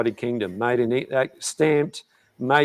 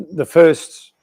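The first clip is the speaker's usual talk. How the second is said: normal talking voice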